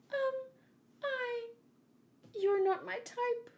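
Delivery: high, small and hesitant; timid mermaid princess. Acted. The mermaid princess shyly refusing the prince, high and small.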